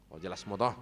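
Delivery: ordinary talk, nothing marked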